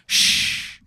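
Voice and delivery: unvoiced hushing shh; whisper. Hidden goons hush the stranger in a whisper.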